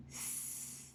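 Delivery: S as a long unvoiced hiss; tongue behind teeth, just hiss S; hissed S sound. Shown as a demonstration. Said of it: "You put your tongue between your teeth and say".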